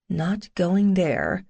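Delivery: ordinary delivery, nothing marked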